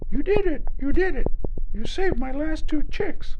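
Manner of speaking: very high, loud joyful bird cry; excited spoonbill. Acted. Spoonbill cheers the gator for saving his chicks. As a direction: cheer him wildly.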